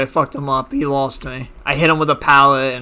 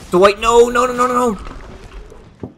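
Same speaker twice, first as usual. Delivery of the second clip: panicked; 9 semitones higher, much slower than usual. Real, not acted. Caught by the killer's power.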